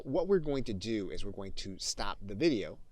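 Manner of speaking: usual speaking voice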